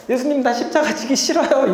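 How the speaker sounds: high, whiny refusal; 어린애처럼 칭얼대며; childish reluctance. Retold or imitated. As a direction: whine like a child who won't go. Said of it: "이러는 것처럼 느껴진단 말이죠".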